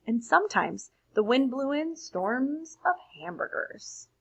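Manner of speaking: put-on lively read-aloud for children; expressive storybook reading to a class; story narration. A narrator's reading. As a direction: read it like a bedtime picture book. Reads picture book aloud.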